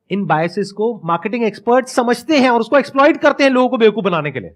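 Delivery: ordinary delivery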